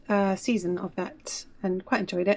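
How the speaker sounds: ordinary talk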